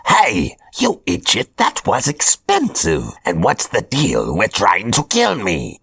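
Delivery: Hobgoblin voice. Acted. Hobgoblin announces his chosen apprentices, smug villain voice. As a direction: play a smug supervillain handing out orders.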